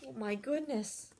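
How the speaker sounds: ordinary delivery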